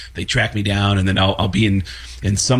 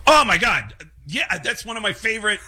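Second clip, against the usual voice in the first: fake enthusiasm; 9 semitones higher than usual. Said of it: "I'll lie and go".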